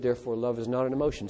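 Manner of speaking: ordinary talk, nothing marked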